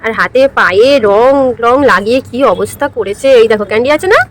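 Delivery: usual speaking voice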